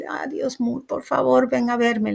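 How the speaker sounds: replaying a desperate plea to God; retold hysterical plea. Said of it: "pero llorando, histérica".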